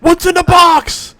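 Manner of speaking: hammed-up shout quoting the film; movie quote shout. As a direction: belt it out like the desperate movie line.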